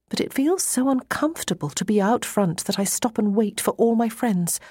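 ordinary delivery

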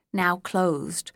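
ordinary delivery

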